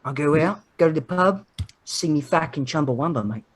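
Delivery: thick mock Australian accent; Australian steelworker. Steel mill worker off to the pub, Australian accent. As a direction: play an Australian guy just off shift. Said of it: "it's like a Australian guy just got out of".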